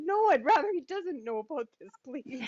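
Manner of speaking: high, embarrassed little plea; pleading. Retold or imitated. Her own retold plea.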